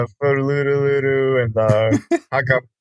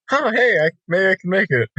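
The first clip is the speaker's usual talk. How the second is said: hopeful; 7 semitones higher, much faster than usual. Retold or imitated. Retold own hopeful thought.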